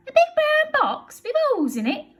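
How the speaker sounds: high, loud amazed echo; Betty's amazed question; astonished Betty. Acted. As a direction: echo it in disbelief. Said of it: "says Burglar Betty".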